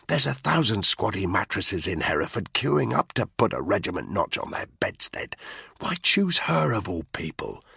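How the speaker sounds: whispery, exasperated telling-off; urgent, hushed disbelief; hushed reproach. A narrator's reading. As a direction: scold a mate without being overheard. Hushed, appalled reproach over secret affair.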